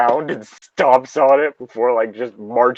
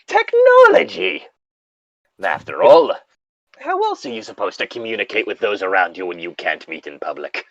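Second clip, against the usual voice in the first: horror host narration; 3 semitones lower than usual. Acted. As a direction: play the campy horror show host. Hosting the show intro.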